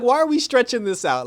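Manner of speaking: high, loud incredulous complaint; exasperated. Acted. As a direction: demand it as a fed-up viewer. Mock exasperation at drawn-out plot.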